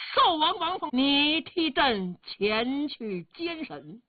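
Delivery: loud, drawn-out royal order; imperial command. A narrator's reading. Emperor sends his brother to oversee the trial, commanding loudly. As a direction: stretch the order out with authority.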